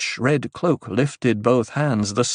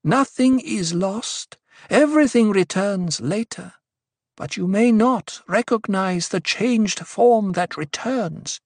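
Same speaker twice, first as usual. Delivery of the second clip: intoned; 10 semitones higher than usual. A narrator's reading. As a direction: intone each phrase, stretched and measured. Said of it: "Aliyah intoned".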